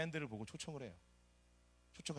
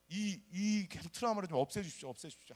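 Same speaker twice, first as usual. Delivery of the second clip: pleading prayer; 6 semitones higher, 6 dB louder than usual. Retold or imitated. Desperate prayer at prayer house.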